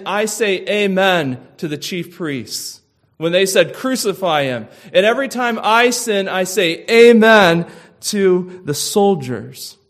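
fervent loud confession, building in force; passionate scream. Pretend. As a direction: cry it out as a repentant sinner. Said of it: "do you ever scream".

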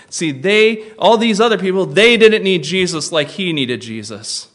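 ordinary delivery

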